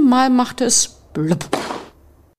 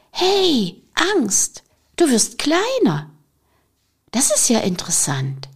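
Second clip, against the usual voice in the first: much higher, bright amazed call; a kid spotting something wonderful; delighted surprise; 4 semitones higher than usual. Acted. The boy marvelling at Fear as she shrinks, delighted.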